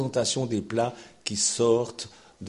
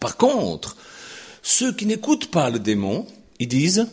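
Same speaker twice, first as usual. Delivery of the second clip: fervent preaching; 6 semitones higher, 7 dB louder than usual. Acted. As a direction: pound it out from the pulpit. Preaching at full force. (prédication fervente et forte)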